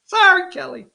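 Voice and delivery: high mocking sorry, Kelly; sing-song taunt in a put-on voice. Mock apology to rival candidate.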